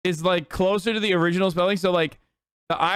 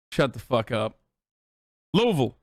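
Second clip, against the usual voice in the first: ordinary delivery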